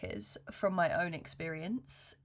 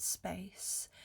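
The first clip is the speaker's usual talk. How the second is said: whisper; 8 dB quieter than usual. Real, not acted. Intimate safe space, whispered.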